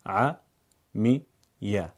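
"عمیه" drawn out very slowly, a-mi-ye; syllabified reading; syllable by syllable. Shown as a demonstration. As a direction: read "عمیه" one syllable at a time. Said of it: "اون رو هجا هجا بخونید".

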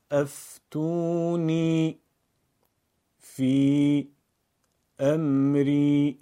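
slow chant of افتونی، فی، امری, pausing after each word; word-by-word recitation. Shown as a demonstration. Teacher practises phrases with learners: افتونی، فی، امری one word at a time. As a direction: stretch each word, then stop briefly: افتونی، فی، امری. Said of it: "کلمه خوانی یعنی خوندن هر کلمه و سپس کمی مکث".

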